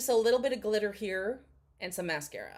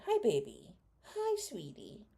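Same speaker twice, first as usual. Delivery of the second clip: tender to the dog; 3 dB quieter, slower than usual. Real, not acted. Comforting her whining dog, soft slow coo. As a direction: coo it gently to a pet.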